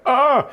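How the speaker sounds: short, high, loud yelp; sudden hammed-up cry of fright; mock scream. Rider starting up the hill, mock scream.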